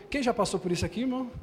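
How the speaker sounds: ordinary delivery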